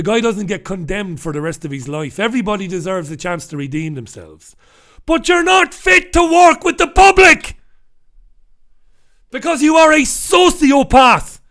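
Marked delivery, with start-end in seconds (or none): 5.0-11.4 furious shout. Real, not acted. Ranting at the offending cop.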